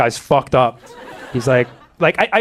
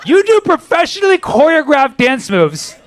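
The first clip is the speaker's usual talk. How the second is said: high, raised comic rant; incredulous outburst; 10 semitones higher, 4 dB louder than usual. Pretend. Comedian berating a dancer failing sobriety test, incredulous. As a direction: burst out high in mock disbelief.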